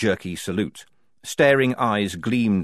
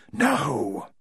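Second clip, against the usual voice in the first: ordinary delivery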